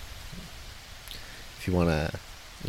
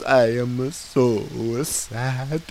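slow, laughing sad clown voice; Mister Panini; 6 semitones higher, 4 dB louder, much slower than usual. Acted. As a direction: moan it like a tragic clown. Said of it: "I was Mister Panini".

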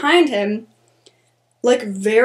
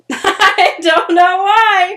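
laughing reply; 8 semitones higher, 6 dB louder, much slower than usual. Retold or imitated. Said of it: "And I was like".

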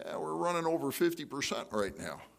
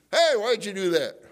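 sudden loud, higher-pitched mock confrontation; mad, confronting; 6 semitones higher, 8 dB louder than usual. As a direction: snap at them in acted anger. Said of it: "we get mad at them".

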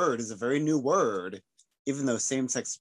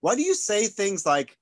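higher, puzzled mock questioning; retold puzzlement, pitched up; imitating puzzled questioners; 7 semitones higher, 4 dB louder than usual. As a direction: imitate people quizzing your odd speech. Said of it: "And then people are like".

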